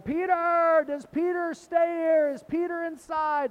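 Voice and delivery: acted high, loud yell, drawn out. Men outside the gate at Simon's house, yelling for Peter. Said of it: "and, and they're yelling".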